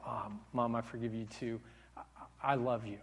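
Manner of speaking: low, very soft affection; quiet retold reconciliation; tender reply. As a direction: murmur it sincerely to Mom. Son forgives mother, retold.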